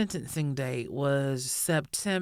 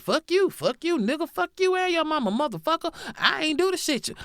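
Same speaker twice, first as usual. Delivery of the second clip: higher, louder hammed-up cursing rant; mock furious yelling; 11 semitones higher, 4 dB louder than usual. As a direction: yell it like an unhinged old man in court. Acting killer cursing victim's son.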